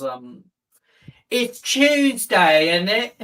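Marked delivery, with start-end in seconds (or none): 1.3-3.1 mock British accent. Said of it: "the worst one's".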